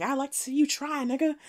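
taunting dare. Retold or imitated. Acts Roulette taunting the threatening man.

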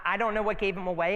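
usual speaking voice

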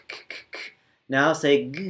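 usual speaking voice

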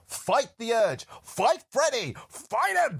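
slow, rising, insistent plea; urgent pleading. Retold or imitated. Lisa urging Jesse to resist, slow rising plea. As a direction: push each fight harder. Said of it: "Lisa reads the diary some more and says".

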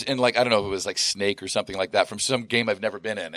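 ordinary talk, nothing marked

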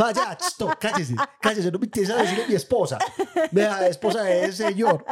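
high, laughing fed-up retort; mock exasperation. Daughter telling dad to stop saying my wife, exasperated. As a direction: brush him off in fake annoyance. Speaker mocks reply to dad.

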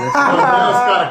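slow, high, laughing outcry; laughing disbelief. Real, not acted. Reacts to pet dwarf remark.

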